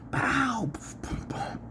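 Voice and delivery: mock blast noises. Sound effects for [name] card.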